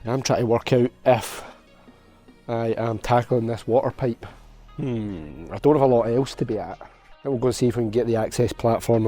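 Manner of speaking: usual speaking voice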